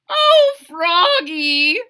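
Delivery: slow high moved voice; touched mother. Acted. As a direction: mum touched by the gift. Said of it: "said his mother".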